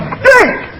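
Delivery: sudden high-pitched comic outburst; head exploding. Acted. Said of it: "my head's gonna explode. It's just gonna go".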